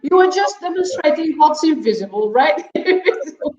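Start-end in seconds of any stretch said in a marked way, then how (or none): 2.3-3.5 laughing. Real, not acted. Both laughing over a misheard word.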